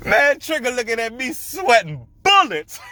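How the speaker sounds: tickled, breaking into laughter; laughing while talking. Real, not acted. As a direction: fight the laugh to finish. Cracking up telling story.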